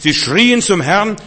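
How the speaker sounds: slow, loud, high proclamation; preacher lifts his voice on the cry. Acted. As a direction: call it out like a cry to heaven. Preaching Israelites crying to Lord.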